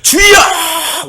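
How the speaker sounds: a long, drawn-out, high cry of "주여"; crying out to God. Shown as a demonstration. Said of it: "여기서는 그냥 부르짖었단 말이에요".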